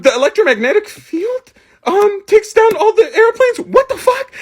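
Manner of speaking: high, slowed read rising to a squeal; high-pitched mock bewilderment; bewildered reading. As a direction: imitate a baffled kid reading aloud, pitch climbing. Confused kid reading nonsense aloud.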